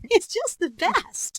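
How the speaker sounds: high, slow gushing doll voice; Barbie. Acted. Toy character delighted with her hair, doll voice. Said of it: "I was also a Barbie".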